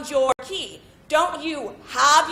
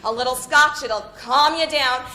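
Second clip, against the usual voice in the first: normal talking voice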